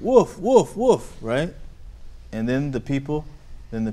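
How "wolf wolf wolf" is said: loud, high cry of alarm; play-acted high shout for help; retold shout. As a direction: call it out as the shouting boy. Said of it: "He shouted".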